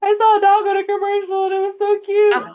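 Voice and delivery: high, loud wailing sob; mock sobbing. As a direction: wail it like a weepy mess. Mock crying over dog commercial.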